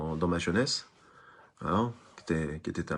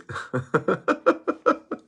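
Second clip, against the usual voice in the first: high laugh; 12 semitones higher, 4 dB louder than usual. Real, not acted. Laughing at priest anecdote.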